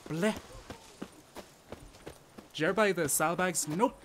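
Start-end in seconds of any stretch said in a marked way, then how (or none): 0.1-0.3 quick "Bleh" flicked off the roof of the mouth; tongue-flick demo. Shown as a demonstration. Streamer showing the Scottish r, one flicked "Bleh". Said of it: "flick the tongue off the roof".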